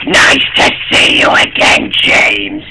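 low ghostly whisper; eerie whisper. A narrator's reading. A hidden voice greeting James, eerie whisper. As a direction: breathe it out like an unseen ghost.

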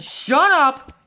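high, loud retort; exasperated outburst; annoyed shout. Acted. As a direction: shout them down in irritation. Snaps at teasing about accent.

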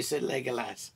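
usual speaking voice

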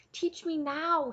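slow, high-pitched plea; pleading. A narrator's reading. Taryn begging Cole to teach him, pleading. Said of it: "he pleaded".

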